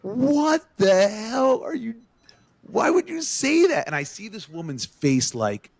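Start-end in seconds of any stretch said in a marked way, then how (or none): 0.0-3.9 high, stunned outcry of disbelief; shocked disbelief. Retold or imitated. Friends shocked at Adam's remark, high stunned disbelief. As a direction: gasp it out, jaw on the floor. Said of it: "mouth drop to the floor like".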